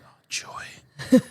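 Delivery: dry sarcastic "oh joy" under the breath; deadpan it, mock delight. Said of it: "And I was like".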